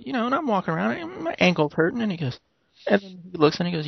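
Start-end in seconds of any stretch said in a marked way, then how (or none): 0.0-2.0 higher, lively comedian voice; retelling the bit in his voice; imitating comedian. Retelling the comedian's bit, higher lively imitation.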